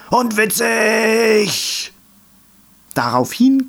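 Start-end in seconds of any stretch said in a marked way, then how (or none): 0.1-1.9 very slow, much higher shouted finale; choir shout. A narrator's reading. Meadow choir shouts the final blessing word. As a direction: belt it out as the chant's crowning cry. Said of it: "stimmten sie an".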